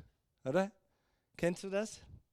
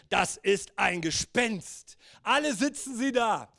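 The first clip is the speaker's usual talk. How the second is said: ordinary delivery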